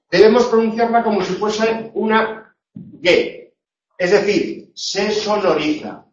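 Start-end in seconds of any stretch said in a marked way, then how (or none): none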